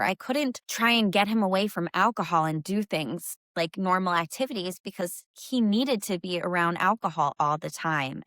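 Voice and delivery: normal talking voice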